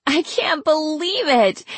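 high, drawn-out howl of disbelief; high, hilarity-filled disbelief; howling with laughter. A narrator's reading. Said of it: "She began howling all over again".

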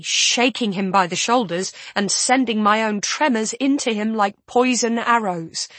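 usual speaking voice

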